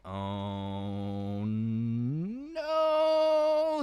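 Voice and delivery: low drawn-out oh sliding up into no; mock dread. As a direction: act out creeping horror, lightly hammed. Retold freaking out in attic.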